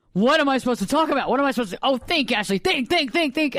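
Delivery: rising, high-pitched panic with a gasp; mock inner screaming. Host urging herself to think before TV, acted frantic. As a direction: work up into a frantic mock meltdown. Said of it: "I'm screaming, just like I'm doing right now".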